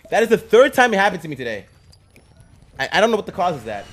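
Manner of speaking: loud fast frustrated rant; raging gamer. Real, not acted. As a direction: rush it out, fed up. Ranting to chat after dying.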